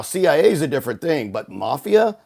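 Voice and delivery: ordinary talk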